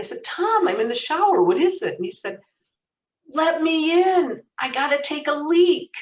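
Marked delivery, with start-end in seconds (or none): 0.2-1.9 high, loud call back through the door; retold annoyed shout; exasperated calling out. Sister in the shower to her brother, exasperated calling out. 3.3-5.9 loud, high, drawn-out plea; urgent yelling. Retold or imitated. Brother locked out of the bathroom, urgent yelling.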